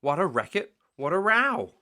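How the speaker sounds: loud, rising cry of indignation, high on row; outraged exclamation. A narrator's reading. Narrator to listeners, waking the farm, loud outraged cry. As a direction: call out the din as a scandal. Animals' din wakes the farm.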